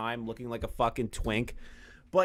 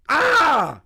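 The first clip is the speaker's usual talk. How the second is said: high, loud frustrated shout; hammed-up shriek of frustration; rage yell; 13 semitones higher, 9 dB louder than usual. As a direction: let out a strangled scream of rage. Mock rage outburst.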